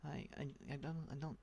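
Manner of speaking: ordinary talk, nothing marked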